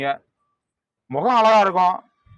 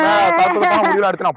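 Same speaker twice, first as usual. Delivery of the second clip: loud, much higher protest; heated outcry; 10 semitones higher, 5 dB louder than usual. Acted. Arguing back loudly, high heated cry.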